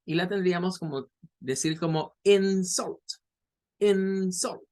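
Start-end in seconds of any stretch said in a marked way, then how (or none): 2.2-3.0 "insult" stressed on the first syllable, IN-sult. Shown as a demonstration. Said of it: "es la primera". 3.8-4.6 high "IN" in "insult", then a weaker "-sult"; stress on first syllable. Shown as a demonstration. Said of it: "es la primera".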